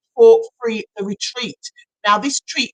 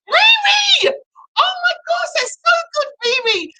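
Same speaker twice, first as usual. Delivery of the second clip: excited shriek. Real, not acted. Host revealing the big prize winner Riri, excited shriek. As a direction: shriek the winner's name with joy. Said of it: "I'm excited for this one".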